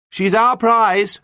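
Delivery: high, loud, slow declaration; called out. A narrator's reading. Captain claims the captured boat, calling out to pirates. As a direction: call it up, stretching each word. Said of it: "called John".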